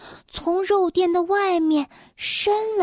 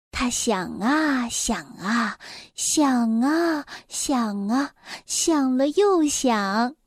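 slow thinking; 3 semitones lower, slower than usual. A narrator's reading. Narrator slowly drawing out Mr. Happy's long thinking. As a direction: stretch each 想 as if thinking hard.

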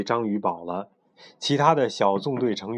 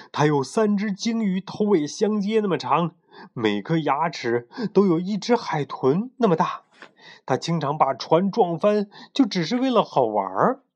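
higher-pitched, animated monster yarn; vivid tall-tale telling; 7 semitones higher than usual. A narrator's reading. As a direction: spin the sea-monster legend with dramatic relish. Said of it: "虽然呱吉讲得绘声绘色".

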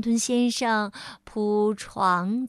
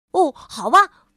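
higher, much louder; brisk voice; 5 semitones higher, 9 dB louder than usual. Acted. Mr. Busy agreeing, high and loud. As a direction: give in cheerfully. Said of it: "忙碌先生说".

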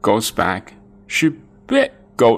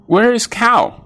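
high calling voice; 12 semitones higher, 4 dB louder than usual. A narrator's reading. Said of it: "said the farmer".

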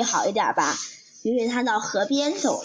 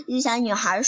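ordinary delivery

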